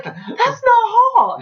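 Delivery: high, slow squeal of disgust; mock disgust. Reacting to the gross kiss, high slow disgusted squeal. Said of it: "And I was like".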